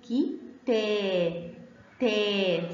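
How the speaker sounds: تا held as a long "taa"; slow teaching syllables, the "aa" drawn out; long sound. Shown as a demonstration. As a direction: sound out كي, then stretch تا slowly. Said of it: "صوت طويل".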